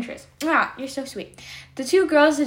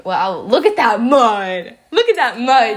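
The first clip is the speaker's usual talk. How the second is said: louder, drawn-out delighted exclaim; excited; 7 dB louder, slower than usual. Real, not acted. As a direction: gush at the mud. Reacting to video.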